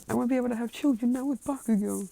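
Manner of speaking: high, squeaky put-on complaint; acted high-pitched protest; mock whining complaint. Acted complaint of upset character.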